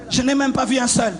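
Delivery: ordinary talk, nothing marked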